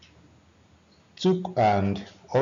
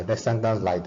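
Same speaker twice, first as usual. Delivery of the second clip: ordinary talk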